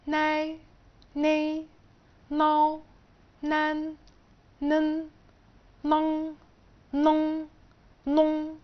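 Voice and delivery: each syllable said slow, high and clear, one by one; pronunciation demo, syllable by syllable; syllable drill. Shown as a demonstration. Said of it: "بعد كده حرف ال".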